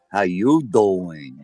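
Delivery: slow, drawn-out New York "How you doing"; Joey from Friends. Retold or imitated. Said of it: "that guy Joey says".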